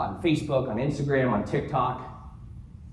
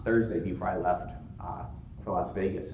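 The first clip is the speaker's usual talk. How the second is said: ordinary talk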